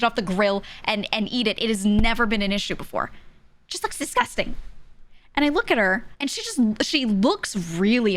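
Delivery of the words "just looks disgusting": high, imitated squeamishness; mock disgust. Wife reacting to sausage on the grill, mock disgusted. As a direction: recoil from it in a high voice. Repeats wife's revulsion.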